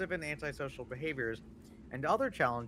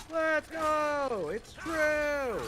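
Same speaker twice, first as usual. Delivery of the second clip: loud and high cheer; excited shout; 14 semitones higher, 5 dB louder, much slower than usual. Real, not acted. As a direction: shout it out in triumph. Cheering at a quiz answer.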